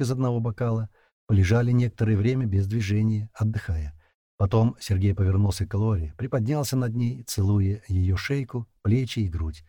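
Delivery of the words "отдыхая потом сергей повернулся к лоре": ordinary delivery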